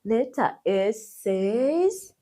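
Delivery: ordinary talk, nothing marked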